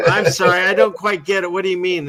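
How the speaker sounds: mock confusion. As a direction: play baffled, loudly asking for an explanation. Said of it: "And then you'd be like".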